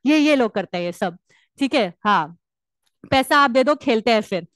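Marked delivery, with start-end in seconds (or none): none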